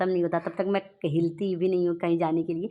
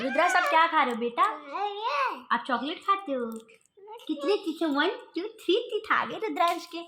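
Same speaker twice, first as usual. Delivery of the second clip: put-on much higher, sing-song talk to a baby; 8 semitones higher than usual. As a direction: count his teeth in a doting baby voice. Mother talks to baby about teeth. (माँ बच्चे के दाँत गिनते हुए ऊँची दुलार भरी आवाज़ में बोलती है)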